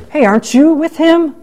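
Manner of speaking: mock-curious accusers; prying bystanders. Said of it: "some people ask Peter".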